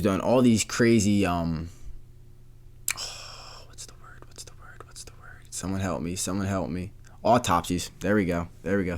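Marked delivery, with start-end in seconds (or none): 3.0-5.4 whispering to himself. Real, not acted. Host blanking on a word, whispering to himself. 5.5-6.2 whispered plea for help. Real, not acted. Host stuck on a word, whispering a mock plea.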